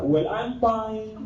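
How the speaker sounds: drawn-out slow mock English; slow mock-English reply. Student answering the Batangas greeting, slow mock English. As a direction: stretch it out like a put-on foreigner. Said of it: "Ang sagot isa".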